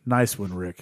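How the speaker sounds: low, understated character quote; imitated Julian. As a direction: drop the pitch for a dry impression. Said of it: "Julian goes".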